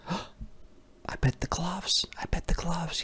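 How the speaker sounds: soft secret whisper guess. Real, not acted. Reader whispers a guess to the child. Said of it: "unvoiced whisper".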